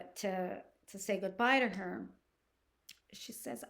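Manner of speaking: usual speaking voice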